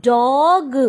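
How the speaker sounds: dog with a loud, long open 'aw'; sounded-out example. Shown as a demonstration. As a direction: stretch dog and release the g. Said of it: "It has to be O". (dog में खिंची हुई 'ऑ' की आवाज़)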